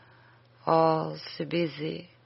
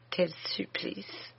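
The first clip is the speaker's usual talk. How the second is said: a slow, breathless whisper; whispered anguish; 9 dB quieter, slower than usual. Acted. Dying sister confessing her torment to Suzanne, whispered. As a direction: let it escape as a pained whisper.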